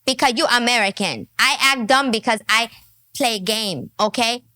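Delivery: put-on lightly done Chinese-accented broken English; a hint of a Chinese accent, lecturing; Daisy. Daisy scolding the woman, in light Chinese-accented broken English. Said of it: "Now, Daisy would tell her".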